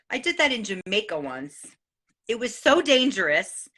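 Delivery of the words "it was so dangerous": normal talking voice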